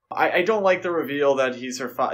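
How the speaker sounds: ordinary delivery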